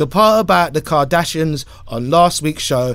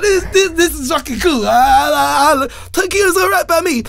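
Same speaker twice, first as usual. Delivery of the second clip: ecstatic; 10 semitones higher than usual. Retold or imitated. Drunk in Tokyo, squealing with mock ecstatic delight. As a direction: gush like the best night ever.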